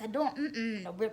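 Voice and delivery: ordinary delivery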